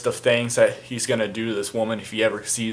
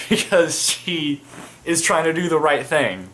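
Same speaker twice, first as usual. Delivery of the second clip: mock indignation; 6 semitones higher, 5 dB louder, slower than usual. Mock outrage at a good god.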